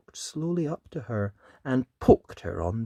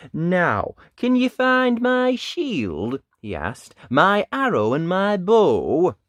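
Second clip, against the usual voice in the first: put-on lively read-aloud voice for children; animated picture-book narration; storybook reading; 3 semitones higher, 6 dB louder than usual. A narrator's reading. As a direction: tell it like bedtime story time.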